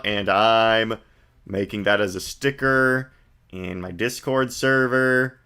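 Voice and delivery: ordinary delivery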